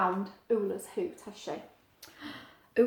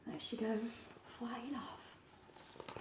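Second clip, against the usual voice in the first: whisper; 18 dB quieter, much slower than usual. A narrator's reading. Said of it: "whispered narration to child".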